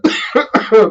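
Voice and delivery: sudden coughing fit; just swallowed a bug. Real, not acted. Swallowed a mosquito mid-talk.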